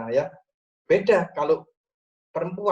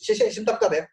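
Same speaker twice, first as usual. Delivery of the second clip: interrupting; 6 semitones higher, 4 dB louder, faster than usual. Retold or imitated. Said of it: "jangan diinterupsi".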